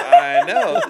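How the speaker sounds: very high, loud cackling laugh; burst of laughter. Real, not acted. Host cracking up at a friend's joke, loud laughter. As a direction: crack up completely.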